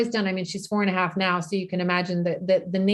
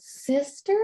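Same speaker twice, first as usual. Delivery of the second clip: put-on higher, curious little-kid voice; toddler's question; 6 semitones higher than usual. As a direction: echo the word like a two-year-old. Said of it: "Just one word, and this".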